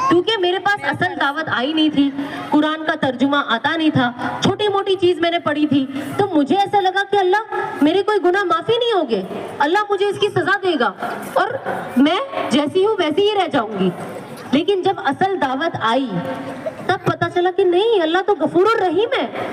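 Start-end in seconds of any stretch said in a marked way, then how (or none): none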